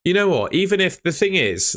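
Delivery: suddenly fired up and emphatic; excited burst. Real, not acted.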